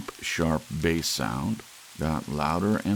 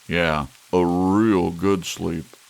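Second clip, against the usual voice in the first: slow dark irony; 2 semitones higher, 10 dB louder, slower than usual. Acted. Dark drunken joke.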